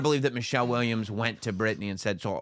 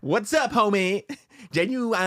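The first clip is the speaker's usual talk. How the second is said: mock audiobook voice; 9 semitones higher than usual. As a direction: echo the reader's cool greeting. Mimicking the audiobook line.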